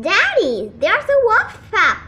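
high, loud excited call; crying out. A narrator's reading. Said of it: "the boy cried".

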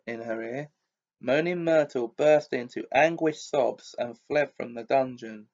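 usual speaking voice

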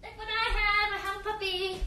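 high singsong puppy call. Real, not acted. Calling and cooing at puppy.

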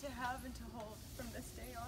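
soft, choked-up vow; emotional vow repeat. Real, not acted. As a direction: hold back tears, softly. Bride repeats vows softly.

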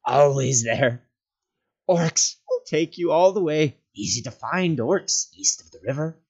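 loud, high, crowing creature; manic, cackling creature voice. A narrator's reading. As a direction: cackle the warning wildly. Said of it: "he cackled".

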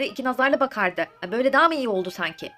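usual speaking voice

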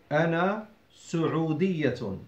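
"سعودية" starting with a clear su-, as su-'u-diyatun; dhamma on the sin. Shown as a demonstration. Teacher correcting a common mistake, "سعودية" said with su- at the start. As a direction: stress the u after the s in "سعودية", never sa-. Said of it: "I emphasize the dhamma on the sin".

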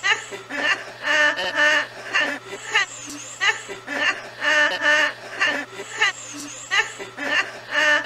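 high helpless shrieking laugh; loud cackling fit of laughter. Real, not acted. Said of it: "Is it really that funny".

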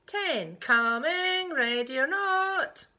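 loud singsong seeker's call; drawn-out playful holler. Acted. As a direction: call out to the hiders, stretching the words. Seeker calls out.

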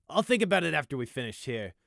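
a hint of gruff officer; dry officer reply. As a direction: brush it off lightly. Bright replies.

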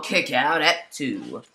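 ordinary talk

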